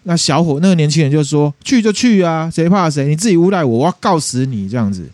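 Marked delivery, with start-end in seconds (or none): 1.7-4.7 higher mock-defiant challenge; angry young man. Young man dares the old lady to go to the police, higher and mock defiant. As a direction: fire back a dare, pitched up but acted lightly. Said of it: "就也是很生气啊".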